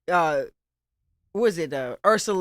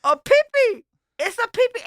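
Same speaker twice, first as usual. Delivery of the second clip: high-pitched, louder mock amazement; acted disbelief, pitched way up; retold astonishment; 13 semitones higher, 4 dB louder than usual. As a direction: squeal it like a shocking discovery. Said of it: "And I'm like".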